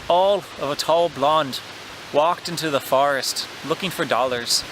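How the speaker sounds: loud Irish accent stretching the open vowels; practising a loud Irish accent; Irish accent drill. Shown as a demonstration. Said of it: "a phrase to help me practice those open vowels".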